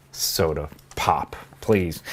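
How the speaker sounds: low, slow mock-proper "Soda pop, please"; lightly hammed-up prissy correction; mock posh. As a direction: drop the voice into a snooty proper-English put-on. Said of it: "I talk proper English".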